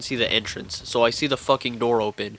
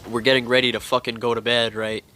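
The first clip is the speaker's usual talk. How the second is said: usual speaking voice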